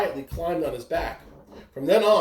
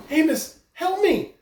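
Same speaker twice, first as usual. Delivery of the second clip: much higher, louder desperate plea; stranded whale pleading; 11 semitones higher, 3 dB louder than usual. Acted. Stranded whale begging the mouse, high and strained. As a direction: cry out for help, voice straining up. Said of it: "said the mountain of a whale".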